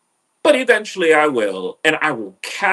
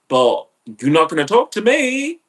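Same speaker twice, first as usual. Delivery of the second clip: normal talking voice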